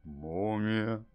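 low crooner's rumble. Acted. Streamer greeting chat as the singer, deep smooth impression.

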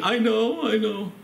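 high, put-on tearful little voice; imitated weepy kid; mock crying child. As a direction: plead it high like a sorry child in tears. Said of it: "she's in bed still crying".

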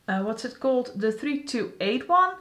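ordinary talk, nothing marked

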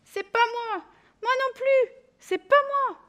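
squeaky little animal voices; high animal voices. A narrator's reading. Animals deny waking child.

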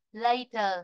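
"later" dropping in pitch to a weak end; falling tone. Shown as a demonstration. Teacher isolates the word's ending, voice going down. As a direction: let "later" fall away. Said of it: "Later là cô xuống dòng".

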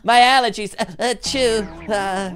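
high, whiny, clumsy character voice; Gary. Acted. Player performing an impression to impress Aphmau, high fretting voice. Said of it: "no Gary impression".

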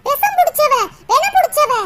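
angry accusation. Acted. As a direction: rail at the culprit in anger. Acted quarrel, accusing Radhika. (கோபமாகக் கத்திக் குற்றம் சாட்டுதல்)

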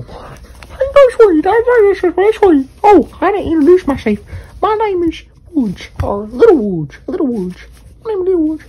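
put-on small boy character voice; high little-kid voice. Puppet Little Woods introduces himself.

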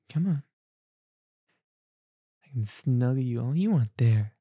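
ordinary talk

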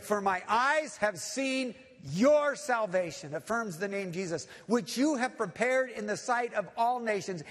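loud, ringing proclamation of praise; full-force preaching. Real, not acted. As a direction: declare it to the whole congregation with fervour.

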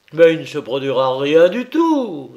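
plus fort, indigné; le ministre proteste avec force; s'écrie. A narrator's reading. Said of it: "s'écria Roosevelt". (le ministre s'écrie devant le policier, plus fort)

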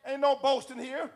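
high, loud preaching shout; full-force preaching. Real, not acted. Preacher exhorting brothers and sisters about the church, shouting fervently. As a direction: preach it at the top of your voice, pitch held high.

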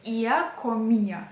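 «іа комія» slowly, a single firm stress on І in each; one clear stress. Shown as a demonstration. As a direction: stretch the І in «іа комія», А weak. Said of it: "має бути один наголос, чіткий".

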